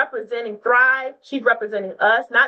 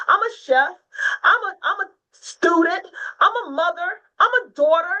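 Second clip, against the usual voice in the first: higher, voice cracking with emotion, listing every role; passionate, near tears; 7 semitones higher than usual. Real, not acted. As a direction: pour it out, fired up and close to crying. Speaker's passionate rant on women's work.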